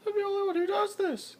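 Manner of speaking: very high, squeaky mock panic; shrill squeal of fake horror; mock whiny voice. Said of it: "don't be like".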